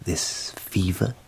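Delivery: hushed, knowing whisper. Real, not acted. Asking if the fever will be fatal, whispered. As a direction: drop to a conspirator's whisper. Plotting the general's death.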